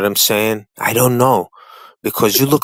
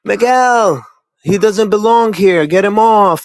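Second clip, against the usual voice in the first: high, loud mocking voice of the chat; 10 semitones higher, 5 dB louder, slower than usual. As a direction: parrot the haters in a high, loud sneer. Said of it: "Every single one of them was saying".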